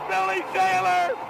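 ordinary talk, nothing marked

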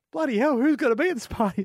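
high, hesitant put-on voice; reluctant bloke. Hermit bloke hedging to the cowboy, high put-on voice. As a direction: hedge politely, wanting to be left alone. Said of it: "the bloke goes".

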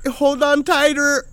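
high, slow sing-song tease; teasing wife. Retold or imitated. Karen teasing from the ride, high slow sing-song. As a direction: drawl it sweetly to mock him. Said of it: "I hear Karen again".

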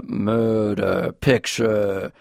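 put-on slow Southern accent on "murder, picture"; mock Southern drawl. Host demonstrates Craig's bad accent, drawn-out Southern drawl. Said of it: "he says the word murder".

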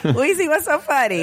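high, amused question; laughing. Real, not acted. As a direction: tease a giggling friend. Teasing a laughing friend.

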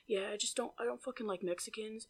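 very soft mock mumble; hushed, barely audible impression; imitated hushed talk. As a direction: imitate him muttering it secretively. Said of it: "like, whispering".

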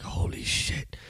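whispered holy shit, hiding in a stall; a panicked whisper from inside the stall. Retold or imitated. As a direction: breathe it out so nobody hears. Hiding in the women's bathroom.

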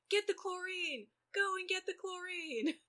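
high, urgent hammed-up command; urgent order. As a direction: bark the order in a panic. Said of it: "And Dean's like".

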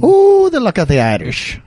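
a hammed-up, badly faked Irish accent; mock Irish accent, lightly done. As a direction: do a horrible stab at an Irish lilt. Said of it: "horrible attempt at an Irish accent".

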